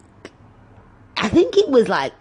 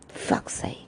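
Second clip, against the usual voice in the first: a muttered, much softer curse under the breath; frustrated whisper; 11 dB quieter than usual. Real, not acted. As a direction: whisper it in exasperation. Annoyed aside after delivery rant.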